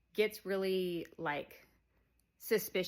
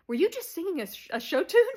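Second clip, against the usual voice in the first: high, loud, fast disbelief; can't believe what he heard; incredulous question; 10 semitones higher, 10 dB louder, faster than usual. Retold or imitated. Cooper catching Matt singing, high and incredulous. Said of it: "Cooper's just like".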